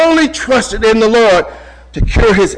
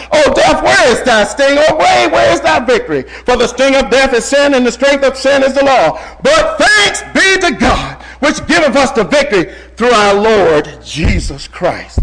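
louder, higher declaiming of verse; triumphant scripture proclamation; 6 semitones higher, 4 dB louder than usual. Acted. Preacher shouting Paul's words over death, in triumphant proclamation.

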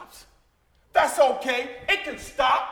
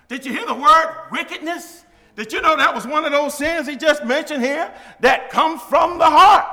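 loud, higher climb peaking on "heart"; fervent preaching; 5 semitones higher, 9 dB louder than usual. Acted. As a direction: build and explode on the last word. Preaching climax.

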